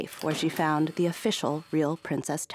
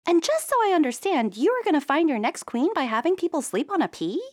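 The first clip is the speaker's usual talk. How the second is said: much higher, disbelieving question; incredulous princess; 12 semitones higher, 4 dB louder than usual. Acted. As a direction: princess checking she heard right, high. Princess voice in story.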